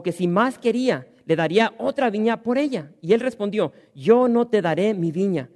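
loud, ringing Bible reading; scripture reading. Acted. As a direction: proclaim the text to the congregation. Reads scripture aloud.

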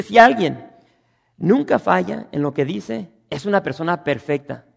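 full-force preaching. Acted. Pastor drives the point at the congregation, loud. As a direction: hammer home that everyone fails.

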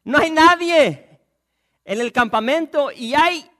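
excited yelling across the wall; shouting lepers. Acted. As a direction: call out the news to the gate.

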